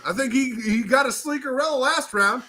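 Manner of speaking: normal talking voice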